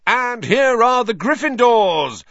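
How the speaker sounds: high, loud commentator announcing the team; excited yell. A narrator's reading. Commentator Lee Jordan hypes the crowd, loud excited yell. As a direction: belt it out to the whole stadium. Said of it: "yelled Lee Jordan".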